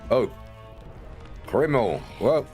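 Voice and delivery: ordinary delivery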